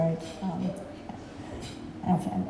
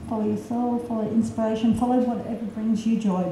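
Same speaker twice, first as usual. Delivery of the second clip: usual speaking voice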